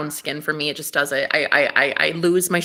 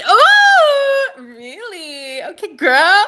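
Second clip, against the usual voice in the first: high thrilled gasp; excited; 11 semitones higher, 8 dB louder, much slower than usual. Real, not acted. Hearing a reading about her priest, squealing high. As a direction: squeal with delight.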